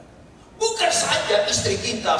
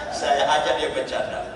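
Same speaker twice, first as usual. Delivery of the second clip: ordinary talk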